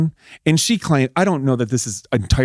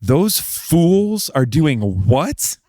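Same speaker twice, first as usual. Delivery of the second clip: incredulous; 3 semitones higher, much slower than usual. Retold or imitated. Husband imitating his wife hearing of the impeachment, slow and incredulous. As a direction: stretch every word in slow disbelief. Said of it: "she said it just like this".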